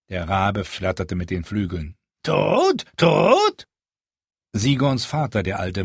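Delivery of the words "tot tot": high, squawking bird echo; croaking raven. Acted. The raven echoes Sigorn, high and croaking. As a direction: caw it twice like a mimic.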